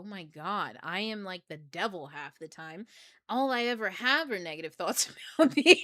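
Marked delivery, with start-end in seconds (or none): 3.3-5.8 high, loud, breaking into laughter; real burst of laughter mid-sentence; laughing confession. Real, not acted. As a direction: laugh through your own confession.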